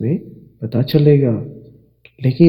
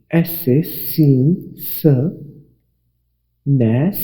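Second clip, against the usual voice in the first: slow sounding out; much slower than usual. Shown as a demonstration. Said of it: "आप यहाँ इसकी आवाज़ को देखिए".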